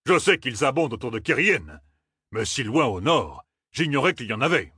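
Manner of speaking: worried, a touch rattled; captain Malia. A narrator's reading. Captain to Tom, worried.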